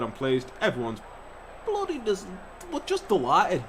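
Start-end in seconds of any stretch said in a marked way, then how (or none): 1.6-3.6 much higher, sheepish; squeaky self-correction. Real, not acted. Catching his own swear, high squeak. Correcting himself mid-word.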